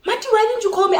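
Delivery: high-pitched imitation of a protesting friend; retold astonished question. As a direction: ask it high, taken aback.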